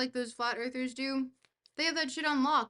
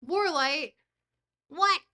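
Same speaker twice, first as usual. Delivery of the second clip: sudden loud higher "what?"; surprised; 4 semitones higher, 7 dB louder, slower than usual. Real, not acted. Streamer calls out a chatter, loud and startled. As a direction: caught off guard, calling out.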